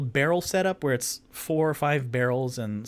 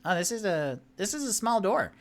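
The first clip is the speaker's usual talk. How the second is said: high character voice; 9 semitones higher than usual. Acted. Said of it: "he goes".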